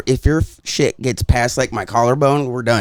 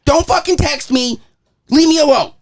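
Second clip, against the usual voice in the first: exasperated rant; 12 semitones higher than usual. Real, not acted. Rant continues after interruption.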